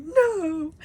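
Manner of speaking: a high, loud cry of no; desperate shout. Retold or imitated. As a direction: yell it like refusing to let him die. Quill refusing to give up.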